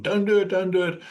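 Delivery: high-pitched pleading in a put-on voice; mock mental screaming. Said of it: "Your mind is screaming at you".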